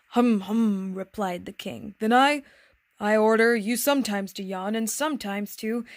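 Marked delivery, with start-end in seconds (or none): 0.1-0.9 louder, mildly vexed humming; vexed sputter. A narrator's reading. King is thrown by the prince's answer, vexed. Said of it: "he sputtered a little and seemed vexed". 2.0-5.8 loud, faltering royal command; sputtering, vexed. A narrator's reading. King orders the little prince about, sputtering and vexed. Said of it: "he sputtered a little and seemed vexed".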